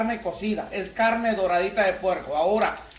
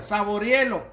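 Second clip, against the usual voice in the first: usual speaking voice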